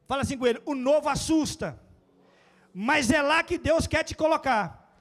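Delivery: preacher exhorting the congregation, full force; preaching at full force. Real, not acted.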